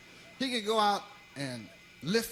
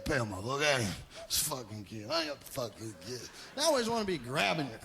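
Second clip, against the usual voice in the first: low mobster; 6 semitones lower, 3 dB quieter than usual. Retold or imitated. Mafia men voiced.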